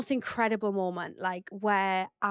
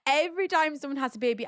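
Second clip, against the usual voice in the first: ordinary talk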